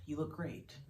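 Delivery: soft, understated sarcasm; dry, quiet jab; sarcastic. A narrator's reading. Daughter looking at drunk father on the floor, quietly sarcastic. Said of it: "sarcasm at a time like this".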